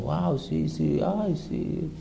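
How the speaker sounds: imitación suave de mujer encandilada; embelesada fingida. Acted. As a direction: suspira bajito, como fascinada, en burla. (embelesamiento fingido, en voz muy baja)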